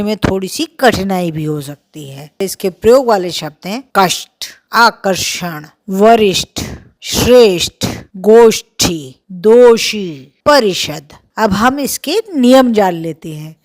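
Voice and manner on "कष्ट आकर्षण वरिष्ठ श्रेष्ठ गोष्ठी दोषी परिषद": वरिष्ठ, गोष्ठी with a heavy curled-back sh, said slowly and louder; heavy retroflex sh words. Shown as a demonstration. Teacher drilling retroflex words for students, heavy and deliberate. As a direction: lean hard on the curled-back sh. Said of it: "गंभीर और भारी उच्चारण".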